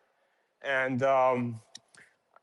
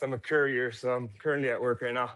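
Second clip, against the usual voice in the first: ordinary talk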